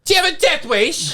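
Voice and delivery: high, hammed-up British yell; mock British cabin crew, high-pitched; British accent. British flight crew scolding passengers, hammed-up British accent. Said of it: "they did it with a British accent".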